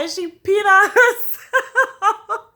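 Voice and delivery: laughing through the words, slow and high; can barely get it out for laughing. Real, not acted. Laughing while retelling the accident.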